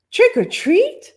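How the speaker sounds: high, slow, careful trick or treat; proper little girl. Acted. Little witch asking properly at the door, high and slow. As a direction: ask it politely like a small child. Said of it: "she asked properly".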